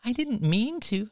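mimicking excuse in a high voice; high, put-on whiny excuse. A narrator's reading. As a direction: pitch it way up, mocking. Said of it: "He pitched his voice higher".

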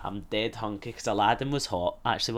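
ordinary delivery

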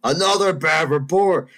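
slow, punched-out mock yell; worked-up teacher. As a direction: imitate a loud, high teacher outburst. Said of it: "she starts getting all worked up".

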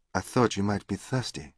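put-on softly spoken French-accented English. A narrator's reading. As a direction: voice her with a soft French accent. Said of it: "in an attractive French accent".

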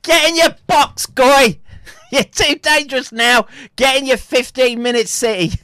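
high, loud mocking command; mock bossy shout. Streamer mimicking orders into the fifteen-minute city, mocking shout.

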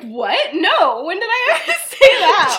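flustered mock outrage; indignant denial. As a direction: protest too much, laughing. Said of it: "And he's like".

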